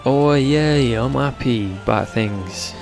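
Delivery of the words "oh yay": normal talking voice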